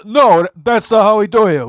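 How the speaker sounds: loud, high mock Chicago accent; Chicagoan. Friend teaching the merch girl Chicagoan, hammed-up accent. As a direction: correct them in an exaggerated Chicago accent. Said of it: "how to be a Chicagoan".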